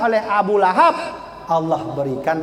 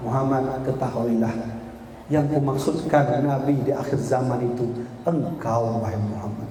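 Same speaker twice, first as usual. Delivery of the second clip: much lower and softer, gently confiding; tender reveal; 8 semitones lower, 5 dB quieter than usual. Acted. Musa quietly tells Muhammad the prophet is him, lower and softer. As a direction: let the voice sink to a hush.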